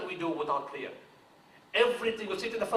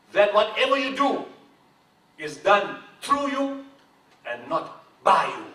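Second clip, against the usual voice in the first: slow, higher and louder, a hint of a wise elder; solemn teacher's counsel; 4 semitones higher, 8 dB louder, slower than usual. Founder quoting his spiritual teacher's last advice, slow and loud. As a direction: hand down the teacher's words slowly.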